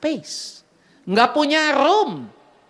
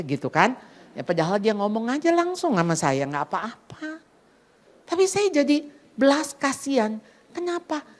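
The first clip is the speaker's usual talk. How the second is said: usual speaking voice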